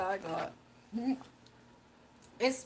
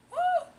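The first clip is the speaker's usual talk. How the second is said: high excited whoop; 8 dB louder than usual. Real, not acted. Excited cheer.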